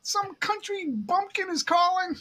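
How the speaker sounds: high, slowing mock call to his wife; imitating a man calling through the house; brother-in-law calling out. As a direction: sing it out high, teasing. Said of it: "her husband answered the phone, and he goes".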